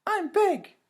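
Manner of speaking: put-on high, loud little-girl voice; indignant little girl; little sister insisting. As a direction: stamp your foot as the small sister. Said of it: "Anna said".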